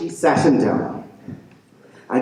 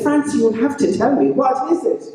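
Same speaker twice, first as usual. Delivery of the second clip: high, pressing question; high worried wife; 9 semitones higher than usual. A narrator's reading. Betty presses Francie, high and worried.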